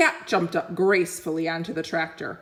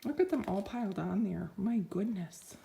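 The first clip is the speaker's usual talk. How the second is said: soft aside; 10 dB quieter than usual. Real, not acted.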